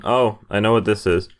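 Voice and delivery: ordinary delivery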